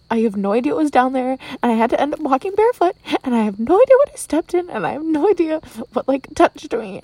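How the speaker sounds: rising panic at what was in the mud; freaked-out rant. Real, not acted. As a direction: spill it out fast, grossed out and rising.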